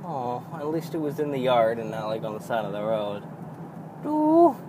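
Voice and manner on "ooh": delighted ooh. Real, not acted. Reacting to tiny kitten.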